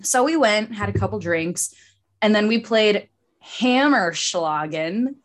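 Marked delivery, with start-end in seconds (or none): 4.0-5.1 slow and deliberate on the tricky word; slow, careful word. Real, not acted. Friend telling the brewery game story needs to process the word, slow and careful. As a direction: say it slowly, thinking it through. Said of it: "I had to say it slow".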